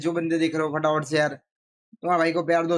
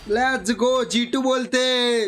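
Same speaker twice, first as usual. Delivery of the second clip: excited cheering; 7 semitones higher, 3 dB louder, much slower than usual. Real, not acted. Streamer cheering a clutch round.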